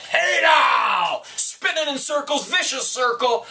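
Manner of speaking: high, loud hype yell; catchphrase shout. Real, not acted. Hyping up the viewers in his bunker, loud high shout. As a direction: shout it out to pump them up.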